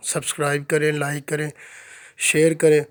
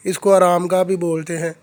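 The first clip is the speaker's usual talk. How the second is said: normal talking voice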